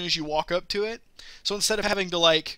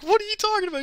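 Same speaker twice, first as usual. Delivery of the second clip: amused disbelief; faster than usual. Retold or imitated. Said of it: "I'm like".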